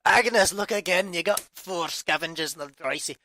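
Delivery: exasperated Scottish outburst; accidental Scottish accent. Pretend. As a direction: slip loudly into a Scottish accent. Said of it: "Why is everyone turning Scottish".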